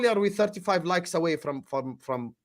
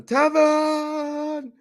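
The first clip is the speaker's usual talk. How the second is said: high, drawn-out call of the name; 11 semitones higher than usual. Real, not acted. Host greeting his friend Tevin by calling his name out.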